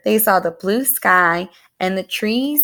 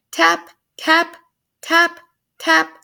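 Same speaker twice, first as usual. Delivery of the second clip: higher, crisp beak taps; tapping sounds; 7 semitones higher, slower than usual. A narrator's reading. Pecky taps her bill against the shell, crisp higher taps. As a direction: peck out each tap like a tiny bill.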